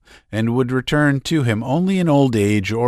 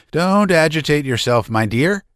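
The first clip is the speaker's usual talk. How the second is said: put-on fussy older lady; 7 semitones higher, 3 dB louder than usual. A narrator's reading. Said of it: "said Maria Konstantinovna".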